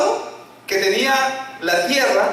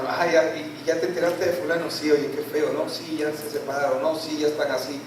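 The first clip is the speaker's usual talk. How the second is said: low, softer confiding chatter; hushed gossip; 5 semitones lower, 6 dB quieter than usual. Acted. Gossips trading news about so-and-so, in hushed tones. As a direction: pass on news in an undertone. Acts out church gossip, dropped voice.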